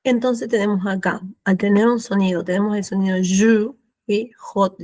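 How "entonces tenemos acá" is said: usual speaking voice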